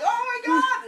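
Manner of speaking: loud high-pitched shriek; mock terrified scream. Lawyer facing the roaring T-Rex, mock terrified scream. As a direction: scream it in hammed-up terror. Acting lawyer about to be eaten.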